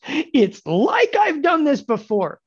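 high, loud, drawn-out gloat; mock boasting. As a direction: ham up the brag, stretching it out. Hammed-up boast after right guess.

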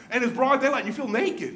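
normal talking voice

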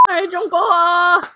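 high, slow squealed hello; swooning squeal. Real, not acted. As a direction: coo at him, squealing. Squealing greeting to idol.

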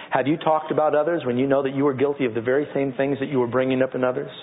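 ordinary talk

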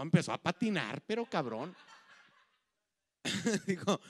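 ordinary talk

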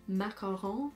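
usual speaking voice

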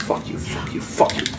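whispering. Real, not acted. Sneaking up on enemy.

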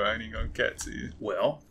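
ordinary talk

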